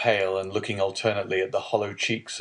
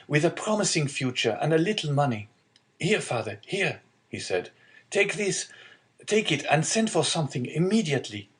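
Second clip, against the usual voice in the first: higher, lightly voiced eager young man; generous young son; 7 semitones higher than usual. Son handing money to father, lightly voiced urging. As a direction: press the gift on him with lifted pitch.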